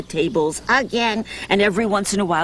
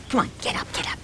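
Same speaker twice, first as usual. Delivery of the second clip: whisper; 7 dB quieter, much faster than usual. Real, not acted. Coaxing the audience up.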